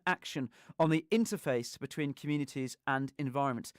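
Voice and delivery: measured, evenly stressed presenter read; scripted radio introduction. Real, not acted. Host introduces scientist for Christmas special, measured scripted read. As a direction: read the guest's biography out with care. Reads prepared introduction of next guest.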